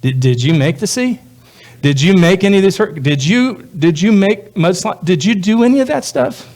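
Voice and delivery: mock-incredulous God, climbing in pitch; God questioning. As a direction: play God pressing the questioner, half amused. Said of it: "God would go like".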